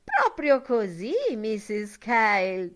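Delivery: put-on high, slow prim old-woman voice; mincing old woman. A narrator's reading. Buck Mulligan playing a prim old gossip to the table, high and slow. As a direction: simper along as the gossip. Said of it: "parlare smanceroso".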